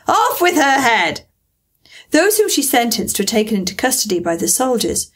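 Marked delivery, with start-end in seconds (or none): 0.1-1.3 drawn-out, raised-pitch royal command; acted queen's cry; shouting. A narrator's reading. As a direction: call out the sentence like an angry queen. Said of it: "and shouting".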